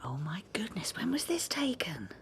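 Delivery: breathless hushed surprise; amazed whisper. Acted. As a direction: whisper your astonishment over the photo. Librarian amazed by photograph, whispering.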